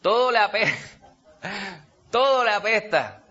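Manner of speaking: sour, grumbling voice of a bitter person; mock a sour grouch who hates everything; grumpy grouch. Preacher mocking a bitter grouch's complaint.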